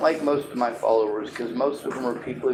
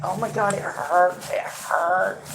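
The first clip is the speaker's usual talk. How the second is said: put-on high, whining child; whiny voice; 6 semitones higher, slower than usual. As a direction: whine it like a five-year-old. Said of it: "my five-year-old annoying whiny voice".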